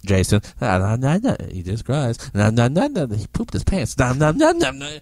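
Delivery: loud teasing singsong of laughing guests; mocking guests laughing. Guests in the next room teasing the child, loud mocking chant. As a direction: taunt the boy in a loud sing-song. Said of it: "you hear".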